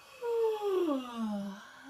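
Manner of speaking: open, rising yawning sigh; relaxed demonstration yawn sigh. Shown as a demonstration. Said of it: "the yawn sigh".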